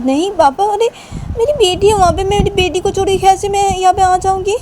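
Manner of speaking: put-on high, pleading girl's voice; pleading daughter. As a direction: play the daughter begging her papa, pitched high. Daughter Ruhi pleads with papa.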